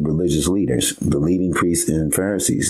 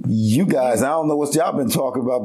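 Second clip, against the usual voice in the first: 1 excited paraphrase; 7 semitones higher than usual. Retold or imitated. Paraphrasing Jesus' listeners, animated.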